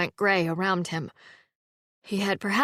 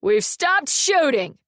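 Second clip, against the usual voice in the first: slow, louder call from a distance; 7 semitones higher, 5 dB louder, slower than usual. A narrator's reading. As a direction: call it across the woods. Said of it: "someone called from the trees".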